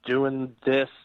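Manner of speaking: ordinary talk, nothing marked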